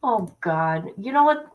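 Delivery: usual speaking voice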